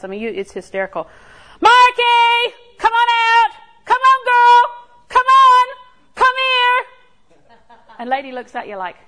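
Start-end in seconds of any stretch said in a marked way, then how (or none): 1.6-6.8 put-on high, loud call for a deaf old dog. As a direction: sing out her name, high and loud, across the yard. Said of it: "This is how we do at the house".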